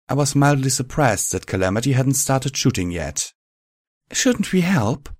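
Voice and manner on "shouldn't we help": much higher, slow gentle mare; Velvet voice. A narrator's reading.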